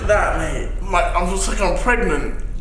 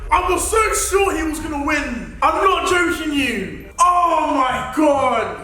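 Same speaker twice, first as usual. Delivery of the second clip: distressed wailing; 6 semitones higher than usual. Real, not acted. Challenger complains to Connor about losing the bet, distressed high wail.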